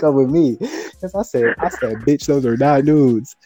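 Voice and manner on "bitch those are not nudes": slow, every word spelled out; "not" pressed flat and deliberate, like stating the obvious; slow emphatic retort. Retold or imitated. Telling the girl off, slow and emphatic. Retelling his angry reply.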